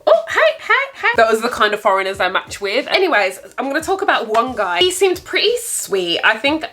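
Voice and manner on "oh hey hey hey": high, lightly mocking sleazy greeting; mock Tinder guy. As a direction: imitate a guy sliding into messages. Mimicking Tinder matches' openers.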